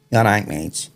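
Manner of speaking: lightly done Scouse woman; a touch of Scouse, imitating her; Scouse accent. Chippy girl greeting a new customer, mild Scouse accent. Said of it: "that was a good Scouse accent".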